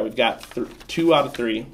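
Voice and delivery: ordinary delivery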